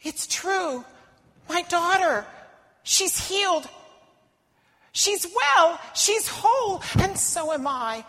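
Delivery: mother learning her daughter is healed; overjoyed. Acted. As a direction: burst with relief and joy.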